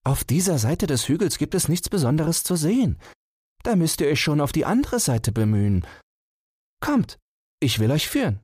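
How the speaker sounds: high, eager hare voice. Acted. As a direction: hare coaxing the tortoise along. Hare lures the tortoise.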